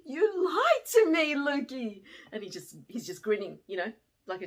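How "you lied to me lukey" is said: much louder and higher, laughing accusation; mock outrage. Teacher scolding Lukey for tricking her, loud mock outrage. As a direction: playfully scold the liar. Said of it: "And then I was like".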